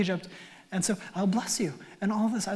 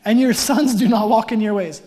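loud, pointed, blunt delivery; loud mock-rude elders; blunt loud reading; 2 semitones higher, 9 dB louder than usual. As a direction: hammer the insult home. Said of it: "That's also not offensive".

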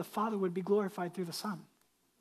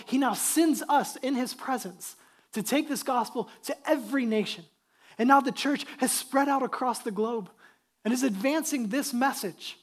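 louder, driving sermon delivery; fervent preaching; 3 semitones higher, 7 dB louder than usual. Real, not acted. Pastor telling the church they are sent to every nation, fervent preaching. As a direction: preach it with force, punching key words.

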